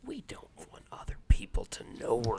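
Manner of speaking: hushed secretive whisper. Acted. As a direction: whisper it like a conspiracy. Conspiratorial aside about alien tech.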